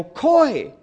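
high, laughing disbelief at the name; stunned recognition. Retold or imitated. Seeing old rival Coy begging at his van, stunned and laughing. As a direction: gasp it out, can't believe who it is.

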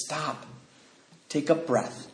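very soft, measured command; calming sponsor. Retold or imitated. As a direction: bring it right down to settle him. Voices sponsor calming him down.

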